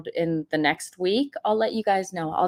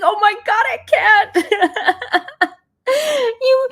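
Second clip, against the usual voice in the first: high, loud laughing exclaim; laughing in disbelief; 6 dB louder, much slower than usual. Real, not acted. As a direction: break up laughing mid-word. Laughing at son's manipulation.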